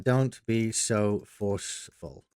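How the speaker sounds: very slow, drawn-out typing voice; slow dictation. Shown as a demonstration. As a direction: stretch "Don't be so forceful" out word by word as you type it. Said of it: "let's do that".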